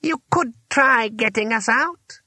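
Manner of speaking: high, slow, pointed reply; drawn-out, high wizard voice; exasperated master wizard. A narrator's reading. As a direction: spell it out slowly to the helper. Trapped wizard replies to offer.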